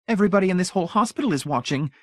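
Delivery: high, fast society lady; confiding gossip. A narrator's reading. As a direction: rattle it off like a gossip. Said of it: "Missus Pritchett revealed".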